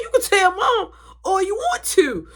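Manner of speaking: high, teary comeback; whiny retort. Retold or imitated. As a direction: snap back at a tattling brother. Arguing with little brother.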